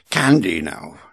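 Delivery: normal talking voice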